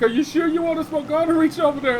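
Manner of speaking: high, mocking mother's voice; taunting mom. Mom taunting her son about smoking, high mocking voice. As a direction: needle him with a sweet dare.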